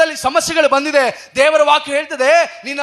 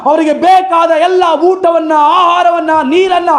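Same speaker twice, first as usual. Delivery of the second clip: raised, high-pitched preacher's cry; fiery preaching; 6 semitones higher than usual. Acted. Preacher rallying the congregation against fear, fiery preaching. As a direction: preach it out full force to the hall.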